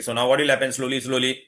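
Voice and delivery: ordinary talk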